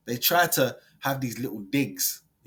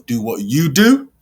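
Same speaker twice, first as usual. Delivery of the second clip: louder, rallying encouragement; hyped louder sign-off; 9 dB louder than usual. Real, not acted. Vlogger backing Benjamin, loud hyped sign-off. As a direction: pump him up like a hype man.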